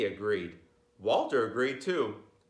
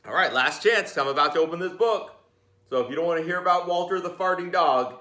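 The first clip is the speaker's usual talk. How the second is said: playful warning; 5 semitones higher, 5 dB louder, faster than usual. Real, not acted. Teasing countdown warning.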